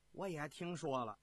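plain reading voice

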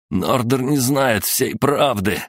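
high, slow pained voice; groaning. A narrator's reading. Tortured prisoner confesses to gang bosses, groaning. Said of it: "простонал Людвиг".

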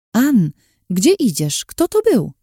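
confused friend calling after Ann; worried and puzzled; anxious question. Acted.

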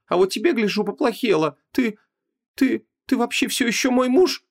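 high-pitched woman, halting then challenging; falter, then question sharply in a high voice; doubting wife. A narrator's reading. Wife asking if he is still her husband, doubtful.

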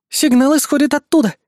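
high-pitched assistant voice; assistant's suggestion. A narrator's reading. Assistant speculating about hunters, high and alert. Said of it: "предположила помощница".

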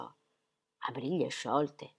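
lenta, bassa, incredula; eco sbalordita. Acted. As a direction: ripete le parole come stordito. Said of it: "le fece eco". (il marito fa eco, lento e basso)

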